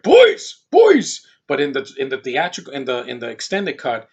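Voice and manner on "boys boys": put-on high, loud shout of the boys' names; grandpa yelling. Grandpa calling the boys back to the car, loud high shout. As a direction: belt it out like a frantic grandpa calling. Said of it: "the grandpa's like".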